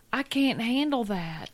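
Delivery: ordinary talk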